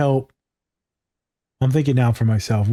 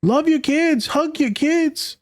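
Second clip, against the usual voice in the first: much higher, earnest imitation of a loving dad; imitating the podcast dad; slower than usual. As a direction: pitch way up and plead simply. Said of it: "He's like".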